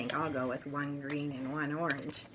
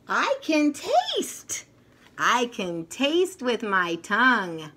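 higher, slower toy voice; toy's voice; 7 semitones higher, 6 dB louder, slower than usual. Acted. Potato Head toy announcing his tongue, high and slow.

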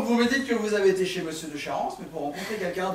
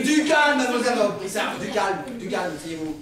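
calling for calm; 4 dB louder than usual. Acted. Inspector quelling an excited witness, loud then calming. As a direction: burst out to restore order, then ease off.

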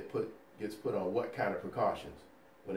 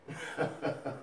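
laugh; 5 semitones higher, 3 dB quieter than usual. Real, not acted. Laughs at own slide.

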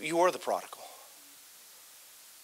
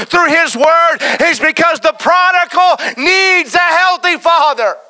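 slow shouted climax; 13 semitones higher, 16 dB louder, slower than usual. Acted. Pastor hammering his final point, slow shout.

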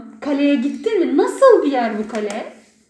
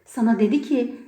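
ordinary talk

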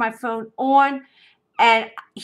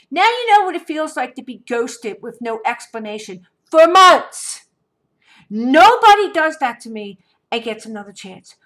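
louder, cutting tell-off; firm scolding; 8 dB louder than usual. Retold or imitated. Retelling how she told off the ex, firm and cold. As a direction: lay down the law. Telling off the ex.

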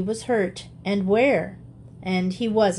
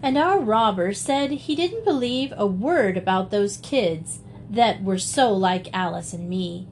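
ordinary delivery

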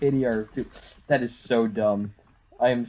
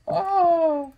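surprised. Shown as a demonstration. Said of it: "Yeah, surprised".

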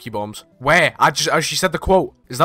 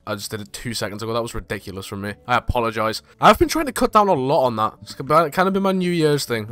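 ordinary talk

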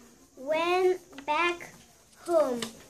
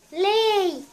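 loud, higher giant order; giant command; 4 semitones higher, 5 dB louder than usual. Acted. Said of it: "said the giant".

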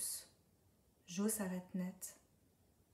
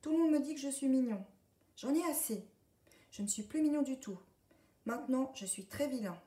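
high, loud, fast duckling rant; pouting duckling outburst; sulky little duck; 6 semitones higher, 5 dB louder than usual. A narrator's reading. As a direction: rattle it off like a spoilt duckling. Duckling complains, now wants to be naughty.

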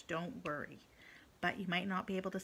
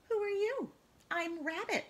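high character voice; 10 semitones higher than usual. Acted. Tadpole meets rabbit.

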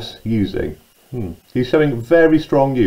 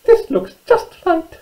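very high, squeaky mouse voice; little mouse; slower than usual. Acted. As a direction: squeak it out like a tiny storybook mouse. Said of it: "she said".

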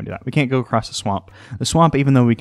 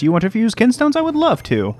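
high excited voice; 11 semitones higher than usual. Acted. Answering Greyblade cheerfully.

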